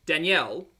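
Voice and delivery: Danielle in two beats, dan-YELL; y glide and full "ell" in Danielle; first of three names. Shown as a demonstration. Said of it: "there's actually three different names".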